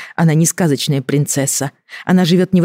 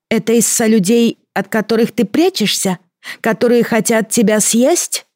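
creature voice; 8 semitones higher than usual. Acted. Creature questions Katya.

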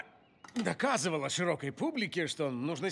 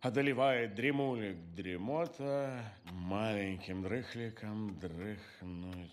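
put-on reading to a toddler; 5 semitones lower than usual. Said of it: "он читает малышу в спальне".

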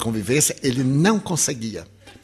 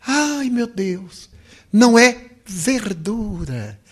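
higher, very slow dawning discovery; sudden realization; 5 semitones higher, much slower than usual. Retold or imitated. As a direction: gasp out the insight slowly, amazed. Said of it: "dizia assim".

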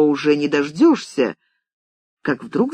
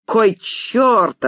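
irritated, higher outburst; curt interruption; 6 semitones higher than usual. A narrator's reading. Writer cuts off George's list of days, exasperated. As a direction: break in sharply with exasperation. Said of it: "оборвал Макхарк".

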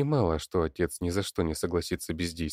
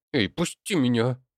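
put-on raised-pitch girl's protest; indignant demand; 6 semitones higher, 4 dB louder than usual. A narrator's reading. Daughter held back by Leonid, indignant. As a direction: demand release, higher and pressing. Girl struggles to be let go.

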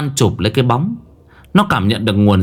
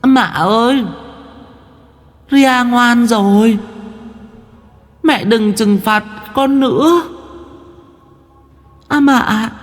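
put-on high, slow, distant child's wail for mama; an eerie little ghost crying out for its mother from far away; thin haunting child voice, drawn out; ghostly child calling mother; 12 semitones higher, slower than usual. A narrator's reading. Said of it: "Âm thanh gọi mẹ lại vang lên".

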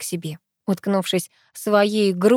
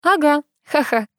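pitched-up, pleased agreement; gladdened; 7 semitones higher than usual. A narrator's reading. Girl backs her friend's story, gladly and brightly. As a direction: chime in with delight. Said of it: "обрадовалась я".

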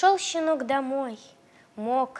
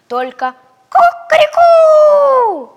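very high, loud, slow crowing; rooster crow; 17 dB louder, much slower than usual. Acted. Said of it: "сказал петух".

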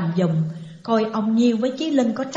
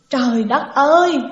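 higher, louder exclamation of shock; acted cry out; 4 semitones higher, 6 dB louder than usual. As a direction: cry out in dismay at terrible news. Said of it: "la lên một tiếng".

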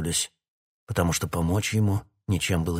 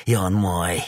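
mad gloating; 3 semitones higher, 6 dB louder than usual. A narrator's reading. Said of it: "разразился безумным смехом".

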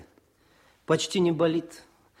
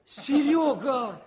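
high, laughing call of a friend's name; light up at seeing an old friend; joyful recognition; 12 semitones higher, much slower than usual. Acted. Officer spots his front friend in the ward, high laughing call.

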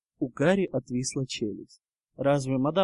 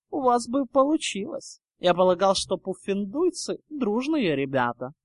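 higher-pitched mocking Draco voice; 5 semitones higher, 3 dB louder than usual. Draco teasing Hufflepuffs about their wands, taunting. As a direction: needle them with mock helpfulness.

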